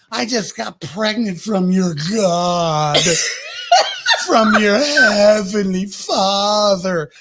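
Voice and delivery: slow, breaking into laughter; drunk laughing outburst. Acted. Tipsy Mary telling Jesus of his father, laughing. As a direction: laugh through the accusation. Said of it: "she's like".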